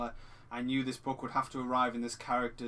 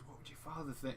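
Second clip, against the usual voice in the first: imitating the teacher, much softer, almost a whisper; 13 dB quieter than usual. Retelling the Disturbia scene, the teacher softly taunting the student. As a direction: lean in and say it under the breath. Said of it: "He whispers that".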